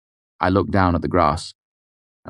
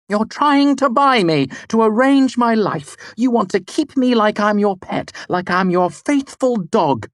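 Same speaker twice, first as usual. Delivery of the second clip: very high, loud accusation; furious; 5 dB louder than usual. A narrator's reading. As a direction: spit the insults. Denna furious.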